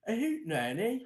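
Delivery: high, laughing mock British question; a badly faked posh British query; terrible British accent. Host mimics UK people hearing the name, bad British accent. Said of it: "That was a terrible accent".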